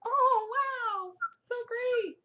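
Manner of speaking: high-pitched, thrilled gush; delighted reaction. Retold or imitated. Recalling good news about stamps, high delighted gush.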